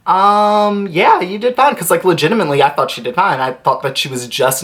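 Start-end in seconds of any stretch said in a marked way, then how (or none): 0.0-1.8 hesitant reassurance. Retold or imitated. Hesitant reassurance to drunk friend, retold.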